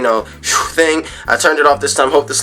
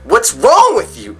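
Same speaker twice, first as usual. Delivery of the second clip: exasperated; 8 semitones higher, slower than usual. Real, not acted. Exasperated at Sony's patent.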